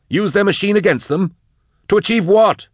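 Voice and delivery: high, loud, incredulous questioning; agitated challenge. Acted. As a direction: demand answers at a high pitch. Drusha challenges the caged plotter.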